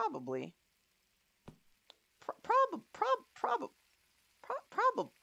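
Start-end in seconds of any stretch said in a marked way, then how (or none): 2.2-5.1 high, squeaky stuttering on each syllable; jammed up, squeaking the same syllable over and over; squeaky stammer. Real, not acted. Gamer stuck reading an ingredient, squeaky stammer.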